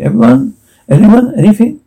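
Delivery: high, rising questions to the group; anxious calling out. Acted. Asking the team if they feel anything, high and hopeful. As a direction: call out hoping someone answers. Checking whether anyone feels it.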